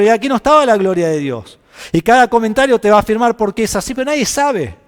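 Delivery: loud, high, driving sermon build; fervent preacher climbing in pitch and volume; preaching at full force. Acted. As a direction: press the point hard to the congregation.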